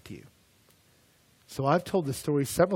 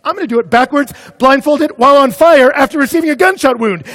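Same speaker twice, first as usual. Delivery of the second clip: daredevil boast yelled high and loud; escalating shout; 11 semitones higher, 17 dB louder than usual. Acted. Daredevil outdoing himself before a crowd, shouting. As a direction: build to a full, high-pitched yell.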